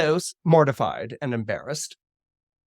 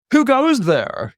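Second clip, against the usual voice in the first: loud, high, drawn-out sentry call; commanding shout; booming challenge; 9 semitones higher, 6 dB louder, slower than usual. Acted. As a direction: call out to an unseen intruder. God challenges an intruder.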